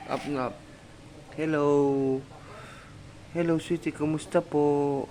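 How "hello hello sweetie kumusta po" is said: lightly raised sing-song voice for a pet. Real, not acted. Owner cooing to her dog Sweetie, in sing-song baby talk.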